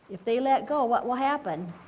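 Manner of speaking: plain reading voice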